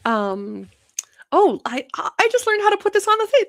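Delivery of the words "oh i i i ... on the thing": high, loud gushing over the comments; thrilled. Real, not acted. Host gushing to viewers over comments, excited. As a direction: squeal with delight at the new trick.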